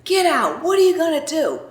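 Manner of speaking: high, taunting dare at another driver; mock-furious, daring him to step out; retold road rage challenge. At a honking driver behind her, a high taunting dare. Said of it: "and I said".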